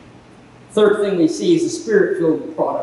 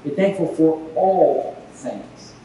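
slow, weighty "all things"; firm command; 3 semitones lower, slower than usual. Shown as a demonstration. Preacher commanding the church to give thanks, slow and firm. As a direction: press down on "all". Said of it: "preacher stresses the command".

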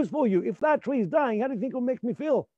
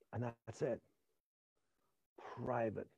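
much lower and softer, close and intimate; private quiet voice; 9 semitones lower, 17 dB quieter, slower than usual. Shown as a demonstration. Actor showing the private voice to a close scene partner. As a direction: talk low to someone who knows you. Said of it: "say my lines like this".

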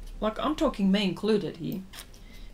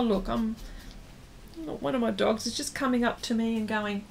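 usual speaking voice

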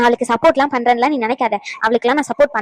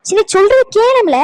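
raised, louder, drawn-out demand; exasperated insistence; 9 semitones higher, 5 dB louder, slower than usual. Acted. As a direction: lose patience and demand to be heard. Exasperated, cutting off the angry partner. (பொறுமை இழந்து உரத்த, உயர்ந்த குரலில்)

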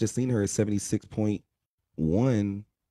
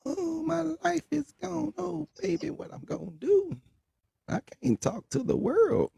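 high, slow, whiny lament over a lost phone; mock wailing phone addict; 4 dB quieter, slower than usual. Mocking phone addicts, a high drawn-out wail. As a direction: wail it like a melodramatic addict cut off from the world.

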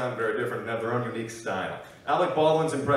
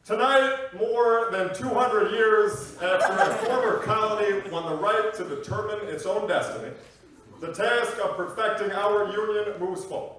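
measured, slightly raised speechmaking voice; Barack Obama; 4 semitones higher than usual. Acted. President addressing the nation, formal speechmaking. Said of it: "Barack Obama making a speech".

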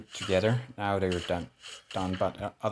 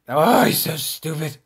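higher, louder outburst; exasperated shout; 7 semitones higher, 11 dB louder than usual. Real, not acted. Gamer shouting at a fleeing enemy. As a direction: burst out, annoyed.

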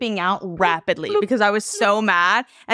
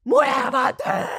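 yelling Spanish worker impression; mock shout of an airport worker; 2 semitones higher than usual. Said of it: "people yelling".

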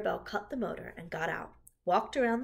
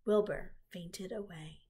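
ordinary delivery

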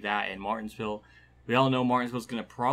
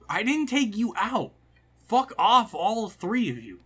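loud, higher, slowed-down yell at the other drivers; angry yelling; 10 semitones higher, 6 dB louder, slower than usual. Real, not acted. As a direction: snap at the rivals who wrecked him. Yelling at rival drivers in game.

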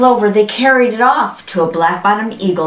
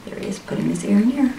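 soft, quick comment on the story; hushed aside; 2 semitones higher, 7 dB quieter, much faster than usual. Real, not acted. Aside repeating the line just read.

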